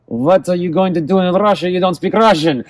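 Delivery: mock the guard in a thick Russian accent; strong Russian accent. Border guard questioning a foreign traveller, strong Russian accent. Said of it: "strong Russian accent and said".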